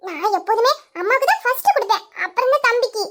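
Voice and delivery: put-on high-pitched small child's voice; little child. Child asking to kiss mother and baby, childlike. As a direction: pipe up like an eager little kid.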